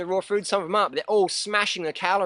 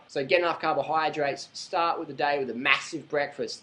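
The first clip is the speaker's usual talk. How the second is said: ordinary talk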